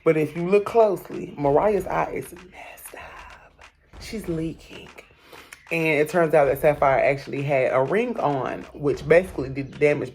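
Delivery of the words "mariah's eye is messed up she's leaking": whispered aside. Real, not acted. Pointing out the injury.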